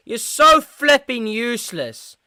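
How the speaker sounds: high, loud, drawn-out frustration; slow, loud moan of exasperation; exasperated berating. Real, not acted. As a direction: berate the player, stretching the words. Berating wasteful player during match.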